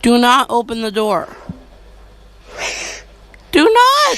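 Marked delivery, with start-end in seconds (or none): 3.5-4.2 high panicked yell; panicked shout. Real, not acted. Panicked pleading at screen.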